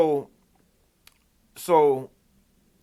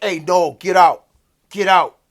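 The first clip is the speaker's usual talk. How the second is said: louder barked order to get out; retold shout; 4 semitones higher, 9 dB louder than usual. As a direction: play the brother shouting her out of the car. Brother ordering them out of car.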